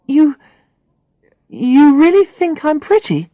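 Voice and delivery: amazed. Acted. Alex asks Brad, amazed.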